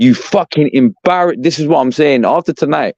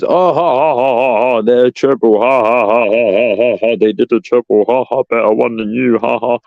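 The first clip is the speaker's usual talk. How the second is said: jeering fake laughter at United fans; mock mocking laugh. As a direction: fake taunting ha-has at rival fans. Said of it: "try and laugh at Man United fans".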